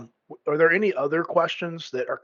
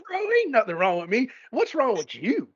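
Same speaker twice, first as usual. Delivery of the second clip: sassy, high comeback imitating Donald; cheeky retort; 10 semitones higher than usual. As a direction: toss back a cocky high "bro". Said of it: "Donald, you're just like".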